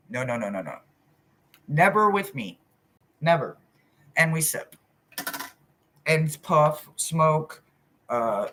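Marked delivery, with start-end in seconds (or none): none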